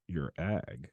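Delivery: low, soft, stunned echo; baffled deadpan. Retold or imitated. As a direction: repeat it back quietly, acting dumbfounded. Her own baffled reply, retold.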